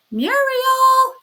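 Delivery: high, loud calling cry; birdlike cry. Acted. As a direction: call out high like a bird. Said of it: "A voice, like a bird, cried".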